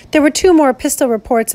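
ordinary delivery, nothing marked

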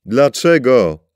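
high, loud, slow drawn-out cry; despairing yell; anguished shout. A narrator's reading. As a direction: hurl the question at the beast. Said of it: "krzyknął w stronę gada".

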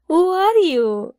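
high laughing tease; giggling while teasing a friend. Acted. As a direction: laugh the question out in disbelief. Teasing friend about Elsa.

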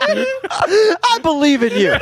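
high, slow, laughing through it. Acted. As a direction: crack up mid-line. Mocking the arm writing, laughing.

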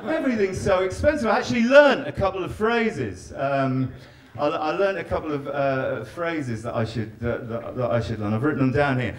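ordinary talk

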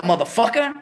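ordinary talk

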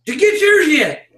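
high, loud carnival call; barker hook. Retold or imitated. Barker luring passers-by to his tent, loud hook. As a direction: bait them with a loud question. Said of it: "I'd get their attention again".

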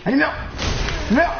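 high, eager cries of meurs; will the boss to die now; urging shout. Real, not acted. Streamer urging the boss to die, high eager cries.

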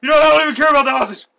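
loud, high, fast retort; mock outburst. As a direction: shout it as a fed-up negotiator. Plays fed-up negotiator in hostage bit.

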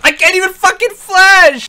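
high, loud furious yell; angry outburst. Real, not acted. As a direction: yell in outrage. Raging mid-fight.

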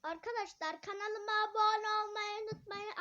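shouting. Real, not acted. Kid shouting to viewers over a weak tablet mic. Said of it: "Ben niye böyle bağırıyorum".

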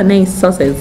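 usual speaking voice

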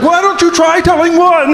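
high put-on heckling voice; mock heckler. Someone challenges the comic to tell a joke, mock heckling. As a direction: pitch it up as a jeering heckler.

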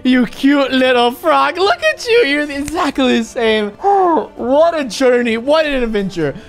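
high overjoyed cries; joyful squealing. Real, not acted. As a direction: squeal with delight at a pet. Reunited with frog.

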